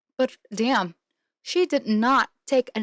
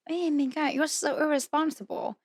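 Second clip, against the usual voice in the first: mock scolding boyfriend; 5 semitones higher, 4 dB quieter than usual. Acted quote of scolding boyfriend.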